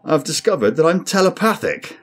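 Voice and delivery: touch of desperation. A narrator's reading. Crewman confessing a strange secret to his captain, desperate. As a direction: blurt it out, pushed high and loud. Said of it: "went on with a touch of desperation".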